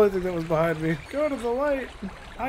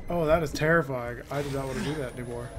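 ordinary talk

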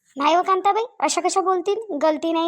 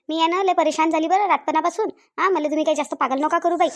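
usual speaking voice

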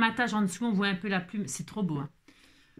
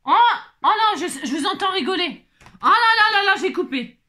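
loud, high-pitched cry of dismay; high, loud "oh no" panic; dismayed outburst; 8 semitones higher, 8 dB louder than usual. Real, not acted. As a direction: yelp out the mishap, half amused. Has just cut the pouch by mistake.

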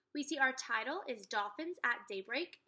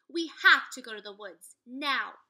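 loud, urgent plea; 12 dB louder than usual. Acted. Annie urging Jack to the woods, loud and urgent. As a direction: push him to go now.

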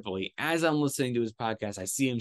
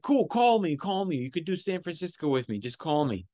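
called reply; 6 semitones higher than usual. Retold or imitated. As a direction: shout it, then let it settle to normal. Tripoli shouting back across street.

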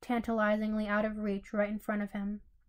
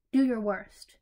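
louder, defiant dare; defiant challenge; 6 dB louder, slower than usual. Acted. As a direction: throw down the gauntlet.

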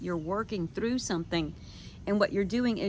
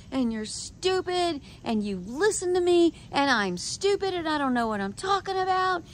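high-pitched, heated tirade at everyone; mock angry rant; 6 semitones higher than usual. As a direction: rail at the whole room, pitch up. Retold rant at trip attendees.

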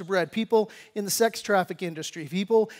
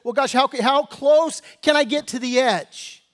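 higher, louder whiny voice of a compromising churchgoer; mock church member; 4 semitones higher, 5 dB louder than usual. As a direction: play the guy testing how close to the edge he can get. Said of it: "this attitude that says".

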